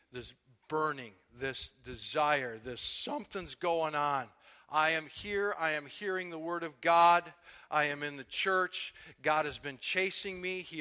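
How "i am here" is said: ordinary talk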